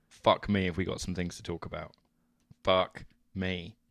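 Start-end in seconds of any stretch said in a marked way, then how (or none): none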